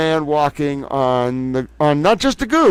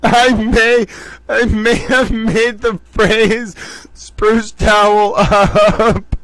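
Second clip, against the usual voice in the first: higher, slow, struggling through laughter; laughing to tears; 4 semitones higher, 4 dB louder, slower than usual. Real, not acted. Host confesses to viewers, fighting back laughter. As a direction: fight the giggles to get it out. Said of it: "I'm crying. Te-, towel tears of laughter".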